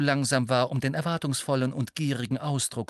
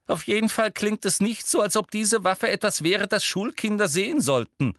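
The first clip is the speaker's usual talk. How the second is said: high, forceful, bossy orders; snappish; 10 semitones higher, 4 dB louder than usual. A narrator's reading. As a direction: lay down the law sharply. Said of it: "fragte Umbridge bissig".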